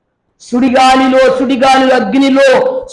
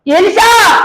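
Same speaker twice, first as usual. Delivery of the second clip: calling out; 9 semitones higher, 4 dB louder, much slower than usual. Acted. Elijah calling to Elisha.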